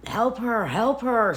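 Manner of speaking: high, loud yelled plea; retold scream. Boyfriend screaming at the cops to help his girlfriend, loud and high. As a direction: yell it out, begging for help. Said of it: "I heard him screaming".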